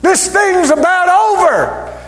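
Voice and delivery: ringing shout announcing the King; triumphant preaching shout. Acted. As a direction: preach it out high and stretched. Preacher voices triumphant cry from heaven.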